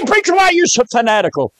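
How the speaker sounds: high, quick mock objection; imitated complaint, high and hurried; challenging listener. Preacher voices a challenging listener.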